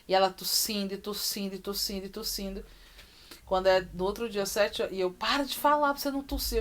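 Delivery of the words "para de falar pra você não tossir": higher, fast, anxious pleading; desperate plea. Retold or imitated. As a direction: rush it out like a frightened mother. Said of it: "eu desesperada". (mãe desesperada pedindo à filha que pare de falar, voz mais aguda e rápida)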